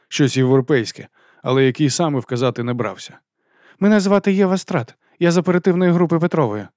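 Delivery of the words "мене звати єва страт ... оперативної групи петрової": high-pitched formal woman's voice; accented businesswoman. A narrator's reading. Official introducing herself to a teacher, formal woman's voice. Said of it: "Її мова мала маленький акцент".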